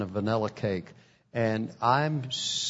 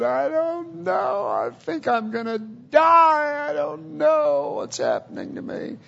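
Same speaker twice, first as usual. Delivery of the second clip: much higher, a terrified cry that breaks into a shout on die; a man sure he is dying, crying out in fear; panicked terror; 4 dB louder than usual. Acted. Dying man panics to his wife.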